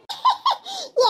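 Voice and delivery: high-pitched, light laugh; amused chuckle up high. Acted. Amused at a friend's biryani offer, laughing.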